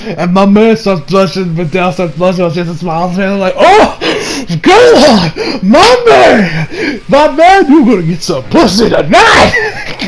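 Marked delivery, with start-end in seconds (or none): 3.6-10.0 much higher, louder hype with cracking laughs; excited cheering. Real, not acted. Reviewer cheering Gohan over Videl, high laughing hype. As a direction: hype up your buddy, half laughing.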